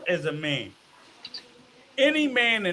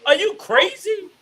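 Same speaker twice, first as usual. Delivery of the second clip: incredulous; 9 semitones higher than usual. Real, not acted. Incredulous question.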